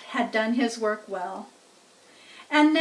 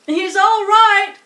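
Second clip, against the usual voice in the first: higher, louder, slow anxious cry; cried out; 9 semitones higher, 12 dB louder, slower than usual. A narrator's reading. Farmer defends his fainted pig, anxious cry. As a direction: call out to reassure them. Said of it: "cried Mister Zuckerman".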